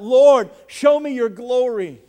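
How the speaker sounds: loud, drawn-out plea to the Lord; crying out. Acted. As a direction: cry out slowly, imploring God. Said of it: "Moses cried out".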